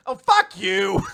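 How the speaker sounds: high, loud playful outrage; mock outraged yell. Two girls yelling at the smug teacher, playful mock outrage.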